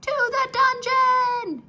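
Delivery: high, loud, slow crowd shout. Acted. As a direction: roar it as an angry mob. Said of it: "shouted the mice".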